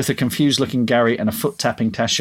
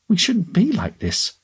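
high, slow, strained plea; half scream; 8 semitones higher, slower than usual. A narrator's reading. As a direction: let it rise towards a scream, held back. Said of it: "half screamed".

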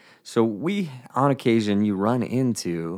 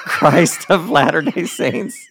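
much higher, louder, slow, laughing through it; reliving an embarrassing blurt through laughter; laughing shout; 7 semitones higher, 7 dB louder than usual. Retold or imitated. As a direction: crack up while shouting the church name. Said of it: "That's how I said it".

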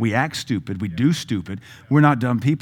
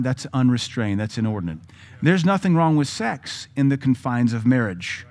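ordinary delivery

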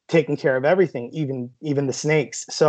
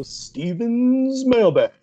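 slow, drawn-out, higher show-intro call; mock announcer intro; 8 semitones higher, much slower than usual. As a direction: announce it like a radio segment. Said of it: "now you gotta go".

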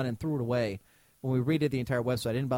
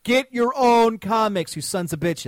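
loud, high, drawn-out holler; exasperated rant at the offenders; indignant outburst; 6 semitones higher, 7 dB louder, slower than usual. Real, not acted. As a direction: yell it slowly, then drop on the insult. Ranting about British actors in roles.

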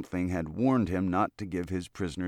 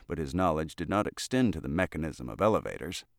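plain reading voice

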